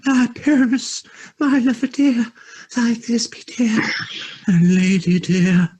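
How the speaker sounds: high falsetto lady voice, hammed up; tiny voice trying to be fierce; monstrous little voice. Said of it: "I'll speak in a monstrous little voice".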